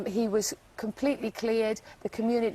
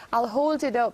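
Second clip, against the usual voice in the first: normal talking voice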